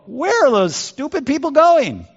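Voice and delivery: high-pitched, drawn-up incredulous question; acted-out high, scornful disbelief; incredulous Egyptians. Egyptians voiced mocking the cornered Israelites.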